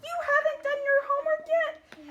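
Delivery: high, loud squeaky mom. Shown as a demonstration. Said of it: "their voices get all high and squeaky".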